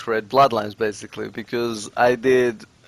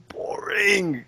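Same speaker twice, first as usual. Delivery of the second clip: drawn-out, higher-pitched "boring"; bored verdict; 6 semitones higher than usual. Real, not acted. Player judging Minecraft without friends, exaggeratedly bored. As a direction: stretch the word in exaggerated tedium.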